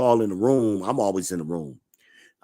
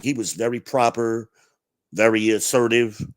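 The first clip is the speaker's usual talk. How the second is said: ordinary delivery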